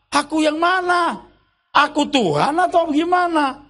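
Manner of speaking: ordinary talk, nothing marked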